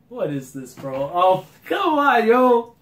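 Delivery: high, loud hype shout; real fan thrill breaking out; loud rising fan cheer; excited outburst. Real, not acted. Fan sees a favorite character return.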